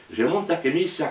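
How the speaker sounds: plain reading voice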